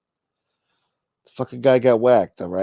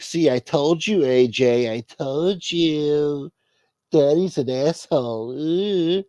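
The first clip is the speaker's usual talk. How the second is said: higher, slow smug teasing; smug daughter; 4 semitones higher, slower than usual. Retold or imitated. Daughter gloating at her brother in court, smug teasing. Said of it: "Meadow sitting there with a fucking smirk, like".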